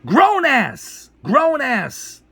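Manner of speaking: high, drawn-out indignant cry; outraged rant. Real, not acted. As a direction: throw the words out in loud disbelief. Angry rant at complainers.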